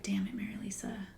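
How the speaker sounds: hissed self-scolding under the breath; quick exasperated whisper to herself; frustrated whisper. Retold or imitated. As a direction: whisper it fast, annoyed at a missed shot. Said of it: "I would huff and be like".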